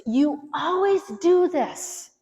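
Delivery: high, loud, slow accusation; accusing absolute. Shown as a demonstration. Wife blaming her husband over the cupboards, accusing. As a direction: throw it at him as a charge. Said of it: "Avoid using absolutes".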